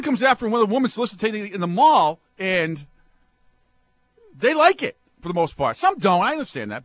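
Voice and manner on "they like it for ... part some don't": normal talking voice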